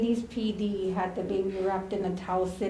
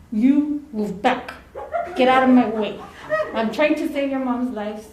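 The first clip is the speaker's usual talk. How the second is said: retold firm command; 3 semitones higher, 6 dB louder than usual. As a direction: imitate a partner ordering someone aside. Partner orders a cop aside.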